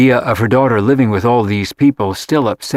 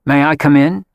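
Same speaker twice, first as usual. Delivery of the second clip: imperious; 4 semitones higher than usual. A narrator's reading. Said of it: "She looked expectant and imperious".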